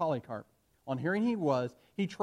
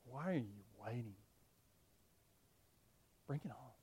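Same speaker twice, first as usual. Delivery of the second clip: much softer, reflective repeat; quiet echo; 4 semitones lower, 13 dB quieter than usual. Shown as a demonstration. Preacher echoing Polycarp's words to the church, softly. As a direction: hush it back as a lesson. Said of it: "That's faith".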